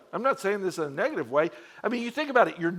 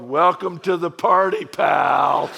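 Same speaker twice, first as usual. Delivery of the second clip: louder mock action-hero taunt; Die Hard quote; 7 dB louder, slower than usual. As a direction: shout it like a movie cop welcoming a rookie. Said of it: "looking at him in Die Hard mode".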